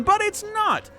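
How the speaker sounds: sudden leap into a very high voice; high-pitched mock protest. Caster voicing the player's thoughts, high-pitched mock protest.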